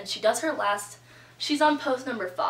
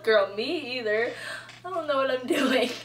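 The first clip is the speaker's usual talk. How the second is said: 1 laughing along; 3 semitones higher than usual. Retold or imitated. Telling the nervous girl she's lost too, laughing. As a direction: laugh it off with her. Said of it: "and I was just like".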